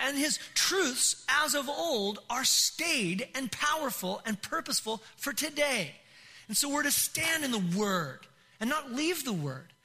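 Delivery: fervent, rousing sermon delivery; impassioned preaching. Real, not acted. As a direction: drive each phrase home to a congregation. Preaches at full force.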